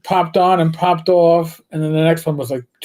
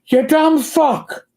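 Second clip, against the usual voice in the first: higher, slow mock Irish yell; Irish accent yell; 10 semitones higher, slower than usual. The Irish bartender yelling at him on the phone, in a mock Irish yell. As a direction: bark it in a put-on Irish brogue. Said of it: "yelled at in an Irish accent".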